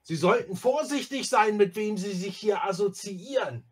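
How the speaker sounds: high, loud indignant address; pompous public warning. Acted. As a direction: warn the whole crowd. Erasmus warns all the guests.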